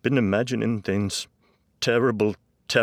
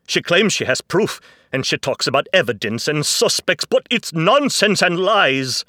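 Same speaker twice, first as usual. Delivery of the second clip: emphatic, building outburst, much higher; distressed father getting louder and more defiant; 7 semitones higher, 14 dB louder than usual. A narrator's reading. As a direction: insist at full voice, fluent and heated. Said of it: "Dad became louder, defiant, emphatic, no longer crying".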